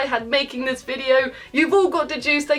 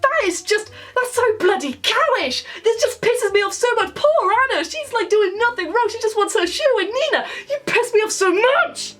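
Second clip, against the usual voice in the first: much higher, heated and worked up; exasperated rant; 8 semitones higher than usual. Real, not acted. Vlogger fuming about Nina hitting her sister, heated rant. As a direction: vent your outrage at the screen. Ranting about Nina's behaviour.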